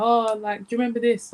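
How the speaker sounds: imitating mum. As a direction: quote mum in a lifted voice.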